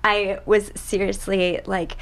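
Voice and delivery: ordinary delivery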